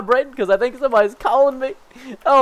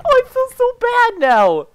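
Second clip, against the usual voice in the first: mock guilt; 13 semitones higher than usual. Real, not acted. Guilt after attacking friendly player.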